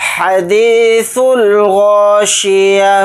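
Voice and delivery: الغاشية ending in a silent ha sound; slow chant, final ة as ha; stop on ha. Shown as a demonstration. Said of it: "ہا ساکن بنا کر پڑھیں گے۔".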